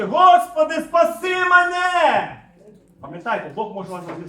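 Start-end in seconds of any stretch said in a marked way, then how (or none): 0.0-2.4 full-force high shout, last words drawn out; desperate cry. Acted. Sinking man calls on the Lord to save him, shouted cry. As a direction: cry out for rescue at the top of your voice. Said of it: "він закричав".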